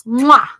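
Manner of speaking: smacking kiss with a lift; chef's kiss. Acted. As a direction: blow a big kiss. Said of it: "Big, big kisses".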